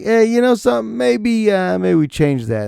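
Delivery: high, light put-on voice floating an idea; a playful high voice pitching a silly change; mock suggestion. Speaker mocking a timid suggestion, high put-on voice.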